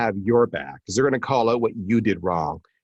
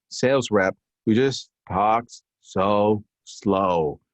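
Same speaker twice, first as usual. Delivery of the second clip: very slow, lower, dragged-out delivery; slow talker; 5 semitones lower, much slower than usual. Shown as a demonstration. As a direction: imitate the slow rep. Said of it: "but they talk like this".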